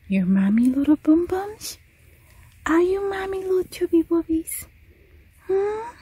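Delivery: high singsong pet talk. Real, not acted. Owner doting on her scared dog, high baby talk.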